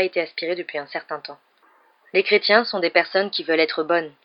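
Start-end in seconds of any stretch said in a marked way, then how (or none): none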